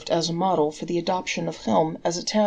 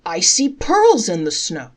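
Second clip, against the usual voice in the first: slow, much louder shout; an elder shouts about pearls in the snow; 9 dB louder than usual. A narrator's reading. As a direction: call out in wonder. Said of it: "another shouted".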